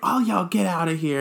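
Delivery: hammed-up send-off; mock bossy dismissal. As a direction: play a power-tripping dancer clearing the room. Imagined bossy dancer at closing.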